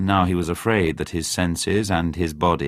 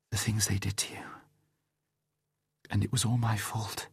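much softer, whispered in tears; tearful whisper; 3 semitones higher, 10 dB quieter than usual. A narrator's reading. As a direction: whisper it, guilt-stricken. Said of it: "Her eyes filled with tears".

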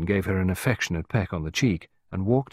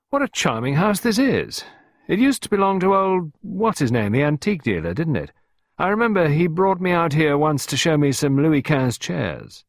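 high, louder sociable drawing-room chatter; sudden charm; 8 semitones higher, 4 dB louder than usual. Acted. Costello to Clarissa, turning suddenly charming and chatty. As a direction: switch on the smooth charm. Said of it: "adopting a complete change of manner".

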